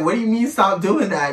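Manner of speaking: indignant. Retold or imitated. Woman retelling her comeback to her partner's order, indignant. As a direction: throw the order back in mild mock outrage. Retold argument, challenges him.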